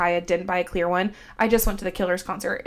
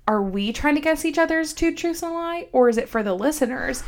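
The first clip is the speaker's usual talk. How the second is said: higher-pitched quote of your own question; retold question; 6 semitones higher than usual. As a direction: re-enact asking it, pitched up. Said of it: "and I was like".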